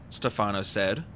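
ordinary delivery

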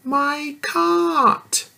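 put-on little girl answering the giant. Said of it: "said the little girl".

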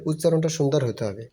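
normal talking voice

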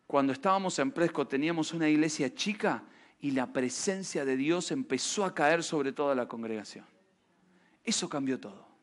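a hint of the old pastor's soft voice; quiet retold quote. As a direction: hush down as you quote the pastor. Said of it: "Me dice".

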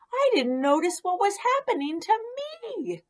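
high, amazed realization; suddenly noticing he changed; rising high astonishment; surprised frog. Acted.

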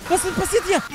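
surprised. Real, not acted. Shocked at something odd in game.

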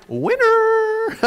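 very high, loud triumphant cry; triumphant winner. Retold or imitated. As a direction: crow it like a game show win. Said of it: "as my brother Kevin said".

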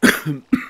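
sudden loud cough; real spontaneous cough. Real, not acted. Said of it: "that was a spontaneous, very spontaneous cough".

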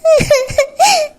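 choked sob. Acted. Daughter breaks down to father.

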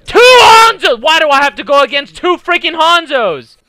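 very high, loud yell easing slightly; exasperated shouted question; outraged protest. Real, not acted. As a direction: scream the complaint at the game. Protesting at two Hanzos.

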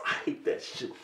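a whispered grumble; whispered annoyance at the sock-eating floor; whispered aside. Real, not acted. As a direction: hiss it under your breath.

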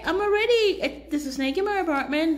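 high, loud, breathless alarm; blurting it out in real fright; real panic. Real, not acted. Panicked opening about a snake.

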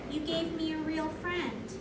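usual speaking voice

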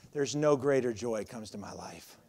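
ordinary delivery

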